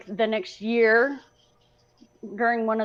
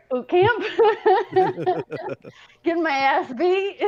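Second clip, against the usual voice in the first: real laughter through the words, much higher; laughing; 9 semitones higher than usual. Real, not acted. Veteran recalling boot camp to host, laughing. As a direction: crack up at your own answer. Laughs at own absence that day.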